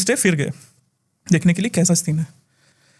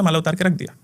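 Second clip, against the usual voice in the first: ordinary delivery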